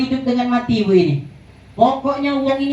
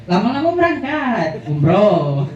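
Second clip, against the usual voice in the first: laughing punchline. Real, not acted.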